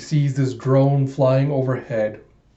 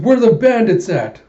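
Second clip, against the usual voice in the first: much higher, loud demanding yell; eager shout; 8 semitones higher, 7 dB louder than usual. Acted. Trigger-happy fighter hunts for bandits, loud eager yell. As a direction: shout it out itching to shoot.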